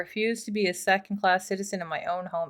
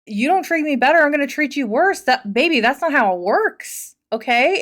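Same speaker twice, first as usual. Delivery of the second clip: voicing the husband; 5 semitones higher, 6 dB louder than usual. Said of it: "he's just like".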